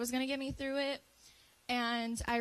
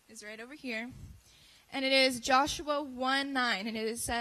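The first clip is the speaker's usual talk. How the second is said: normal talking voice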